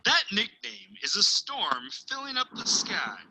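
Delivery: plain reading voice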